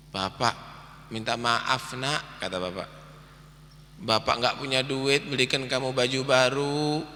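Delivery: ashamed apology. Acted. Father apologizing to his son, soft and sad. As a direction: say sorry gently, ashamed of being poor.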